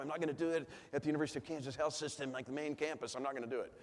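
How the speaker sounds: quick hushed aside, hallway quiet. Real, not acted. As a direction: keep it down, rushing the words.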